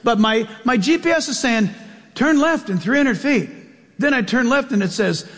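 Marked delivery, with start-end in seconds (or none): 2.1-3.5 prim robotic navigation voice, lightly done; GPS girl voice. Retold or imitated. Pastor mimicking his GPS giving directions, mock sat-nav voice. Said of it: "it's in a girl's voice".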